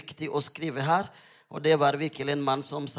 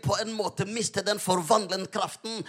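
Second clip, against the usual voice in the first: urgent sermon swelling to loud, higher declarations; fervent preaching, building; 7 semitones higher, 9 dB louder than usual. Real, not acted. Preacher urging believers to speak out, fervent preaching building in force. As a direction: build relentlessly into bold proclamation.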